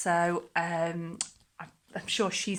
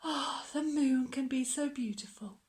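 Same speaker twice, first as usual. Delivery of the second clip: softer, wistful hush; softly sighing; 6 semitones higher, 5 dB quieter than usual. Acted. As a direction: breathe it to the moon. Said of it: "he whispered with a sigh".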